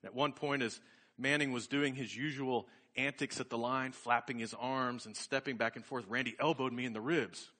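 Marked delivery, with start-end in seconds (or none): none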